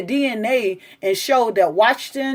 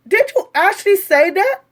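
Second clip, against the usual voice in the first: incredulous; 9 semitones higher than usual. Real, not acted. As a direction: ask as if you can't believe it. Incredulous question repeated.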